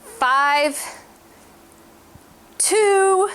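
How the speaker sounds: pushing out the last count under strain. Real, not acted. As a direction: call the number mid-effort. Straining through a workout count.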